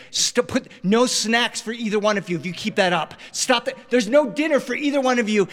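high-pitched dad scolding; exasperated threats. Retold or imitated. Dad to fighting children in the car, high exasperated scolding. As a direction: imitate a parent losing patience with the kids.

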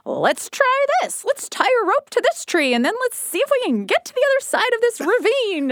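put-on high, eager kid brother voice; zany brother. Sister imitating her daredevil brother, high and eager. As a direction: pitch it up like a reckless kid with a plan. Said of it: "My brother was the zany one that was like".

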